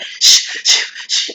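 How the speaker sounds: sharp repeated shush; imitated shushing. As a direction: hush a crowd like a performer on stage. Imitating [name] hushing the crowd.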